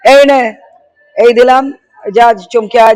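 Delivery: loud, curt toss of scraps; mock dismissive handout. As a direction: imitate someone throwing scraps to strays. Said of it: "কুকুর বেড়ালকে যেরকম করে এরকম করে ছুঁড়ে দেয়".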